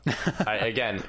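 ordinary talk, nothing marked

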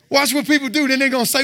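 ordinary talk

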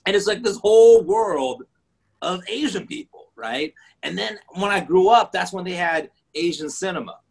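ordinary talk, nothing marked

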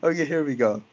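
normal talking voice

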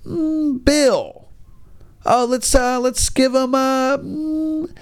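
high, drawn-out musing hums; naming a parent's child, high musing hums; pondering names. Retold or imitated. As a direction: hum high while picking boy names.

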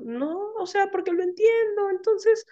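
high, light put-on voice of the indifferent client; shrugging client.

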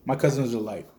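ordinary talk, nothing marked

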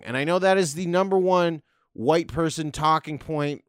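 ordinary talk

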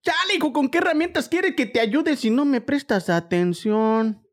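high, slow hammed-up chilango scolding a son; Mexico City accent, exaggerated parent nagging. Playing a chilango parent scolding a son in Mexico City accent. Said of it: "Ay, un chilango".

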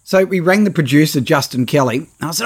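normal talking voice

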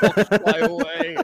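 slow, wistful mopey voice; mournful sad clown whine. Pretend. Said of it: "sad clown squirrel".

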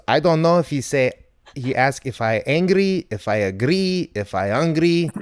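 imitated non-native English accent, lilting upward; ESL learner accent. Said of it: "they were in E S L class".

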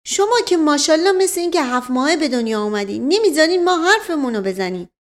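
high, fast grumbling; indignant servant's quick retort; exasperated protest. Acted. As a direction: complain at being cut off. Mashqasem protests being interrupted.

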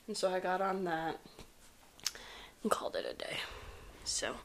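trailing off into a whisper. Real, not acted. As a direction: breathe it out, barely voiced. Tired end to a stressful trip.

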